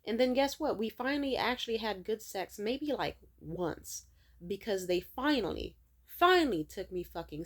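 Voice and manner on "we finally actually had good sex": usual speaking voice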